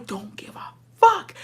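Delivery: slow whispered shrug; whispered defiance. Retold or imitated. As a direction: breathe it out, not caring. Defiant inner thought.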